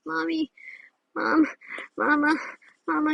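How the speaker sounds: put-on a kid tugging at mom for attention; child calling mom. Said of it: "and I was like".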